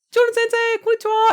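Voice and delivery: loud, very high mock-teacher greeting; high-pitched teacher. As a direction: sing out a hello as the tiny teacher. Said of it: "she talks like this when she talks".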